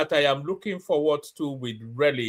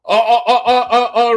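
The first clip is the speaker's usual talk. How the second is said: laughing; 7 semitones higher, 10 dB louder than usual. Real, not acted. Bursts out laughing at clip.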